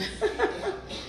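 soft, high giggle; quiet breathless giggling; real laughter. Real, not acted. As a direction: laugh at your own cheeky remark. Laughs at own innuendo.

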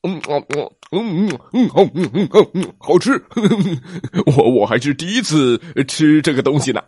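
rising, laughing mmm sounds; happy munching T-rex. Acted. T-rex tasting shellfish for the first time, laughing with joy. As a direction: savour it, giggling with delight.

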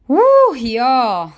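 pumped up. Real, not acted. Podcaster fired up by a quote, pumped up. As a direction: whoop it up, hyped by what you just read. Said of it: "that gets me pumped".

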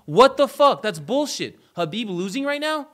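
disbelieving outburst. Retold or imitated. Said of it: "I was like".

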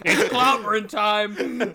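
loud, slow, laughing battle cry; laughing while quoting a superhero catchphrase; laughing hero catchphrase. Retold or imitated. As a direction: bellow it like a cartoon strongman through laughter.

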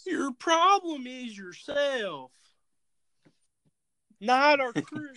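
high, slow, drawled talk-show host; Doctor Phil. Acted. Co-host joins the Phil bit, high drawling impression. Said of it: "That's classic Phil".

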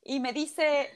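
usual speaking voice

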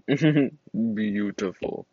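BEAUTIFUL stretched very slowly; slow, savoring Beautiful; drawn out. Real, not acted. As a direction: draw out every syllable of Beautiful. Repeats the word drawn out.

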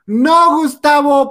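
high, loud "No, Gustavo"; loud retort. Real, not acted. Answering Gustavo's comment, loud retort. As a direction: call him out sharply.

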